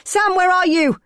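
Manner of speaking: high, loud call across the park. A narrator's reading. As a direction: yell his name into the dark. Searching dark park for him.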